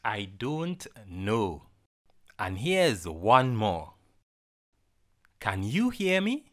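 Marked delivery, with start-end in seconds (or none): none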